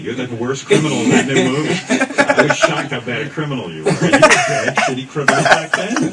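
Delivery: amused ribbing, laughing as you talk; laughing teasing. Real, not acted. Host teasing guest about his bad crimes, laughing. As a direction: tease through laughter.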